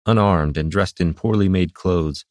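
ordinary delivery

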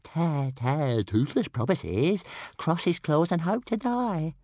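high, stuttering creature voice; little dragon voice. A narrator's reading. Toothless promising Hiccup to behave, squeaky dragon voice. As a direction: promise solemnly like a small stammering dragon.

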